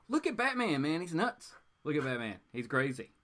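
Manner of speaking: usual speaking voice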